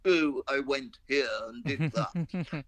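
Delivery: slow, put-on actor's voice; mock posh actor. Mocking an actor reading his life story, slow and hammy. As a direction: ham it up like a luvvie actor. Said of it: "an actor trying to go".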